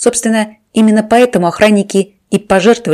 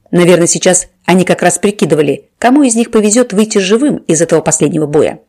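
ordinary delivery

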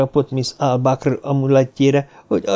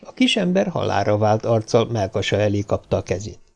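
usual speaking voice

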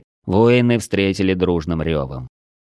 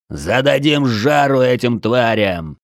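slow, higher-pitched rallying yell; roaring battle cry; 5 semitones higher, 3 dB louder, slower than usual. A narrator's reading. Commander rallies fighters against the beasts, roaring battle cry. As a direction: draw the words out to fire up troops. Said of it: "прорычал Дрой".

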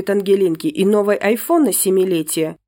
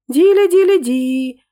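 high sung ding-dong; intercom chime; 8 semitones higher, 6 dB louder, slower than usual. Acted. Intercom ringing, sung high and loud. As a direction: sing the doorbell chime. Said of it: "пропел домофон".

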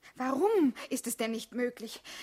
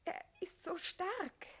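slow, soft, near tears; tearful; 5 semitones higher, 10 dB quieter, slower than usual. Acted. Jessica about Höderer to Hugo, tearful. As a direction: let it catch in the throat.

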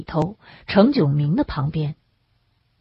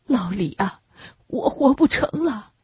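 choked up; 5 semitones higher than usual. A narrator's reading. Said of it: "他哽咽着说".

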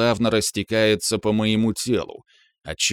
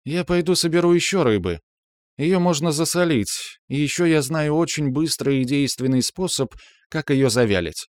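higher-pitched, practical female character; lightly voiced Калантриэль; 7 semitones higher than usual. A narrator's reading. Калантриэль changes the subject to gathering fish, lightly voiced. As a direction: speak as the woman, raised in pitch.

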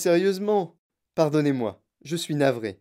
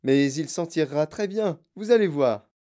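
higher-pitched, lively urging; insistent reassurance; 6 semitones higher, 4 dB louder than usual. A narrator's reading. Wonka calms the panicking mother, insistently reassuring. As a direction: insist brightly that all is fine.

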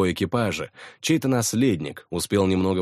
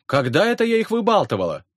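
much higher, theatrical outrage; mock indignation; 13 semitones higher, 4 dB louder, faster than usual. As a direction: play-act being offended. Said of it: "картинно возмутилась Жанин".

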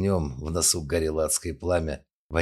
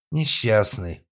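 put-on drunk slurring; 4 semitones higher, 5 dB louder, much slower than usual. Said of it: "подтвердила Вероника заплетающимся языком".

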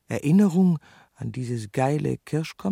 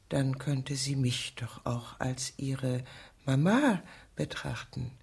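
normal talking voice

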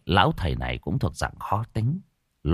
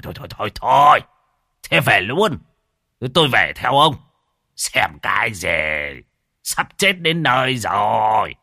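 thôi, thôi, thôi, thôi blurted louder and higher, like the house is on fire; the old shaman sounding a frantic alarm, louder and higher; alarmed fortune teller; 4 semitones higher, 4 dB louder than usual. Acted. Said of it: "nói cứ như là cháy nhà chết người đến nơi". (thầy bói hốt hoảng, nói to và cao giọng)

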